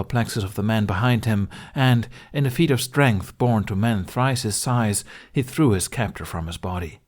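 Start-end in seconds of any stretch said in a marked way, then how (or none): none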